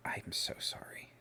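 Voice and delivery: weak, slow whisper; whispered apology. Acted. Injured brother apologizing as he grabs your hand, slow faint whisper. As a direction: barely get the apology out, all breath.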